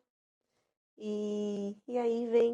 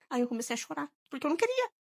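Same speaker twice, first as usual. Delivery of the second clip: ordinary talk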